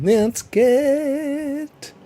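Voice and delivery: high, held-out note; silly stretched words. Real, not acted. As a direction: stretch it out high while stalling. Stalls in a silly drawn-out voice.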